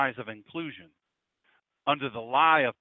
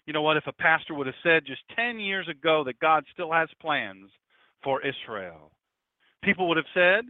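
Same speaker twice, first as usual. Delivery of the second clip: usual speaking voice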